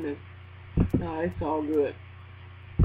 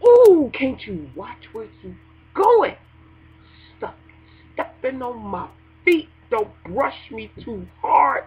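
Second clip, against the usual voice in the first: irritated complaining; 3 semitones higher, 5 dB louder than usual. Retold or imitated. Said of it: "You like".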